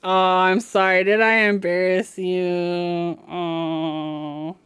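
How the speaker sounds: mock apology to a pet; cooing apology to her embarrassed cat. Real, not acted.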